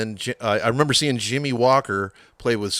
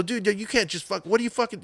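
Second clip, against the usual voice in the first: retold yelling; 11 semitones higher than usual. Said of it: "he was yelling".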